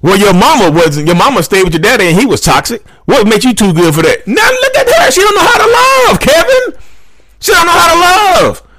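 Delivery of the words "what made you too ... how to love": mock wailing complaint. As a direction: wail it like an exaggerated sob story. Mock woman wailing to Kevin.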